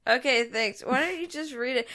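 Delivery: ordinary talk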